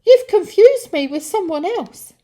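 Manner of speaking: high, loud flustered protest; frantic, high-pitched protest; panicked denial. Acted. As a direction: deny it in a squeaky fright. Frightened Peter denies, high voice.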